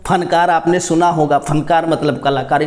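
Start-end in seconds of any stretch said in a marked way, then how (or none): none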